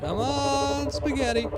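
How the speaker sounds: high, drawn-out mock pleading; high-pitched coaxing. Gamer calling to the spaghetti man, high coaxing. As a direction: coax it out in a high put-on voice.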